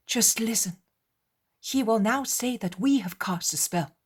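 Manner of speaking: usual speaking voice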